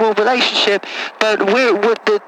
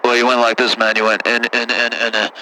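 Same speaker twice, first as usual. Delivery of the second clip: put-on much lower, rough-talking good ol' boy; deep caller voice; 11 semitones lower than usual. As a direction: play a low-voiced country caller. Same caller voice.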